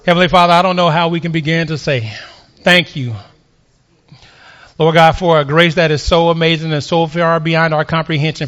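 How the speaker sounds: low, even praying voice; real prayer. Real, not acted. Preacher thanking Heavenly Father for grace, in low prayer.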